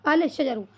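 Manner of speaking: "अल शजरु" with the 'l' fully voiced; lam sounded. Shown as a demonstration. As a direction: say 'al' distinctly before the 'sh' of "शजरु". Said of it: "क्या होना चाहिए था". ("अल शजरु" लाम बोलते हुए)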